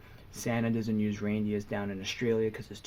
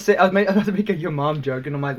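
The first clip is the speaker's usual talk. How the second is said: ordinary delivery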